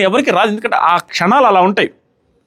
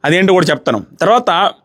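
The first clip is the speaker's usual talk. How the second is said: ordinary talk, nothing marked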